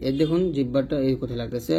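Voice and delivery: ordinary talk, nothing marked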